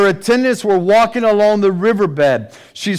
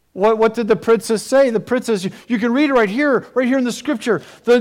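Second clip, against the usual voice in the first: ordinary delivery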